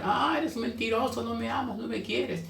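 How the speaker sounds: soft, high tearful complaint; hammed-up little weepy voice; mock crying. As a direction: play a woman whimpering an accusation. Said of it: "Dalila se puso a llorar".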